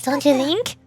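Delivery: ordinary talk, nothing marked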